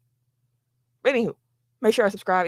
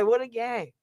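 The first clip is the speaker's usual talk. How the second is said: usual speaking voice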